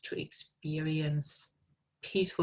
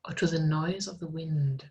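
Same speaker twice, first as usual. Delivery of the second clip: guided meditation; 3 dB louder than usual. Acted. Meditation guide leading students in art therapy, calm and unhurried. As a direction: ease listeners into stillness, unhurried.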